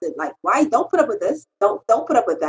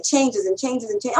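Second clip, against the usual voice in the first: ordinary talk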